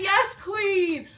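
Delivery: loud, high-pitched hype cry; excited cheer. Retold or imitated. Acted delighted cheer at hoodie.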